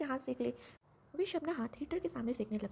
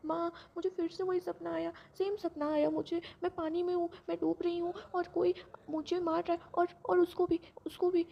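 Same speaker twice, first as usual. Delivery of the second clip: high, louder distraught sobbing words, building; crying in fear; 6 semitones higher, 5 dB louder than usual. Acted. Daughter clinging to her mother after a nightmare, tearful and high. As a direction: blurt it out through tears. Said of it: "ज़ोर ज़ोर से रोने लगती".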